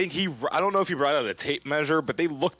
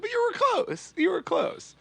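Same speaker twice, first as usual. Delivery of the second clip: squeaky high teasing comfort; high mock consoling. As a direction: pat him on the head, way up high. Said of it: "I'm just always just like".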